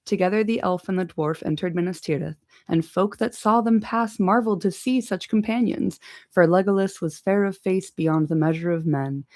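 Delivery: plain reading voice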